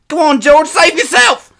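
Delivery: very high, frantic shout; desperate plea. A narrator's reading. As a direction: beg him to leave you and run. Hurt boy begs brother to flee.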